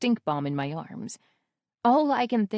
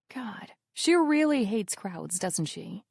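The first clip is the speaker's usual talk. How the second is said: ordinary delivery, nothing marked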